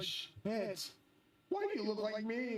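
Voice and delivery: acted-out disbelief, voice lifted; retold shock. Retelling shock at meeting his double.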